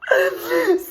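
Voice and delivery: bright burst of laughter; high laugh. Real, not acted. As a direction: laugh out loud at the memory. Laughs after story.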